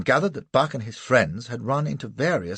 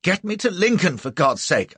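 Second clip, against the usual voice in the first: higher, louder desperate plea; shouting; 7 semitones higher, 4 dB louder than usual. A narrator's reading. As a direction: shout for help. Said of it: "I shouted".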